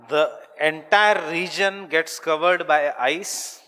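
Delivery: loud, high, slow dictation; dictating loudly. Real, not acted. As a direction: call it out slowly to the whole room. Teacher dictates new sentence to class.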